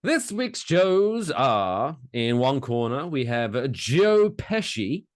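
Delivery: slow, high boxing announcer hype; big fight-night introduction; ring announcer. Acted. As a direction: drag out the names like a ring announcer.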